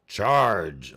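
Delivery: loud battle cry order; a plain loud charge shout. Acted. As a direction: yell it to rally the troops. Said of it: "fairy's like".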